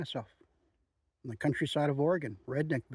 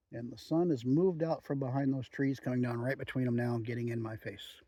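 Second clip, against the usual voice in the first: ordinary talk, nothing marked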